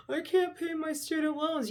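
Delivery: high, whining mockery of a debtor; mock a whining voter; whiny mock complaint. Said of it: "then they wanna cry and bitch about it".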